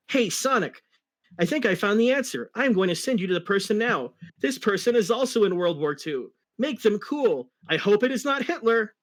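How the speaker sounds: high, eager sidekick; bright young fox. Acted. Tails voiced in story.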